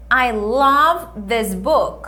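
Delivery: I love this book, very slow, love stressed; slow model sentence. Shown as a demonstration. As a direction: spell it out slowly for students. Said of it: "O que que ela tá dizendo?". (frase modelo bem devagar)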